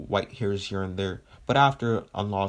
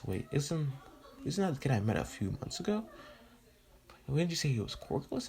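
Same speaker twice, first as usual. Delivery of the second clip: softer, higher puzzled questioning; All Might; 5 semitones higher, 7 dB quieter than usual. Acted. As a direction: puzzle it out quietly as recognition dawns. All Might recognizes the kid, puzzled.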